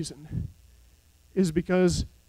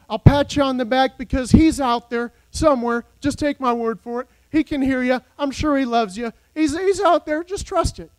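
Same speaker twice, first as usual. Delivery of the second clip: mock reassurance; 5 semitones higher, 4 dB louder than usual. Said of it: "aim... make you feel better".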